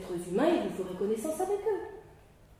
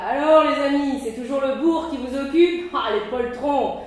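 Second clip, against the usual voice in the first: loud, mocking jeer at the cowards; mock them loudly from the doorway; ironic scoffing; 4 semitones higher, 8 dB louder than usual. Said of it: "D'un ton passablement ironique". (raillerie ironique et forte)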